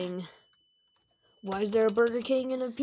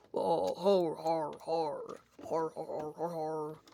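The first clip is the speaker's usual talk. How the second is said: evil laugh; 3 semitones lower, 4 dB quieter, slower than usual. Acted. Freddy villain about to kill.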